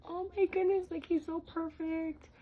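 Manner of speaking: high, gushing over her newborn. Retold or imitated. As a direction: squeal it like seeing the baby for the first time. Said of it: "I literally was like".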